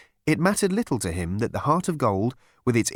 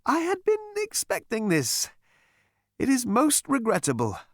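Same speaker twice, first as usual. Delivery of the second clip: mock regret; 11 semitones higher than usual. A narrator's reading. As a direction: feign polite disappointment. Smile flickers.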